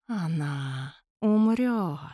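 slow, snide ghostly hiss; snide shadow. A narrator's reading. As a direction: sneer it slowly like a ghost. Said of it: "ехидно повторила тень".

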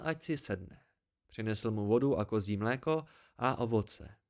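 ordinary delivery

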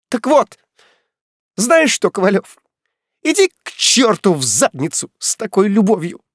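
high, loud furious yell; furious shout. Acted. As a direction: scream it at him. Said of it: "Леся срывается на крик".

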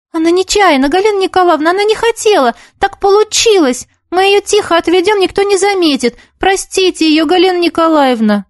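high, urgent pleading schoolgirls. A narrator's reading. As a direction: beg the teacher to let her off. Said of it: "уговаривали Света и Юлька".

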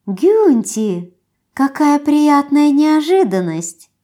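high, slow chirpy coo; chirping. Acted. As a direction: chirp it sweetly like a flirt. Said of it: "прощебетала".

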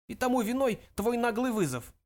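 put-on high, scolding elderly voice; old ancestor's cross reproach; indignant old ghost. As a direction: accuse the great-grandson.

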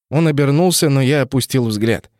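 ordinary delivery, nothing marked

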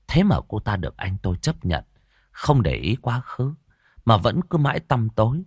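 ordinary delivery, nothing marked

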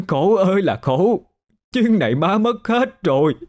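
high, heartbroken mother's lament; grief-stricken keening; wailing. A narrator's reading. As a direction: wail and sob in despair, climbing very high. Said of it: "bà khóc rú lên".

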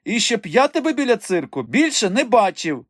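high-pitched parting threat; animated, high-voiced warning; threatening. A narrator's reading. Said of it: "пригрозив на прощання Голий".

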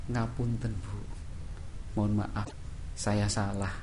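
bow your head and apologise softly; humble apology. Acted.

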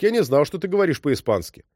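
plain reading voice

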